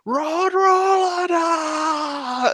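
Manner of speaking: high, slow shouted villain cry; Dio battle cry. Retold or imitated. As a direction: howl it out slowly like Dio. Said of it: "he goes".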